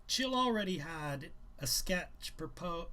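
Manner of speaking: reading a novel aloud, a little livelier; brisk read-aloud narration; story narration. A narrator's reading. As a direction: tell the story to listeners. Reads novel aloud to viewers.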